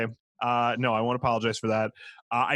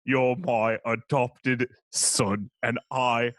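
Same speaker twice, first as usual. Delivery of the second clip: slow, tender Cockney butler voice; Alfred telling Bruce he loves him, in a Cockney accent; Michael Caine impression; slower than usual. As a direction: lay a Cockney accent on thick, slow and fatherly. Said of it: "That's Michael Caine".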